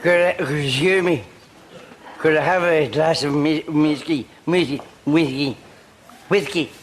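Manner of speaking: put-on drunk slur. Drunk man ordering at bar.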